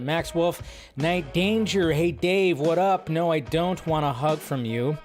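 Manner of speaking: ordinary talk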